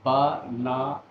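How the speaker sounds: teacher sounding out बा ना; syllable by syllable. Shown as a demonstration. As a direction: split बा ना into slow chopped syllables. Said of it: "इसमें तीन syllables हैं".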